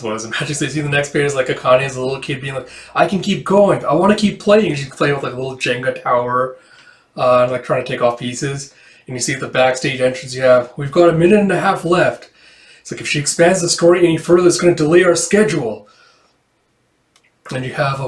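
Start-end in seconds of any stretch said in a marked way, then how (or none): 2.9-4.7 put-on high, eager child voice; little kid voice. 10.8-15.9 worried staff. Acted.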